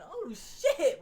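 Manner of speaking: high, loud startled curse; a fan gasping at the slam; shocked gasp. Retold or imitated. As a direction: react like a stunned fan. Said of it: "you're just like".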